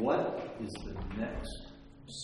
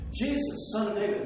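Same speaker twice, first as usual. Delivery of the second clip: calling out; 9 semitones higher, 4 dB louder than usual. Retold or imitated. Woman calling repeatedly.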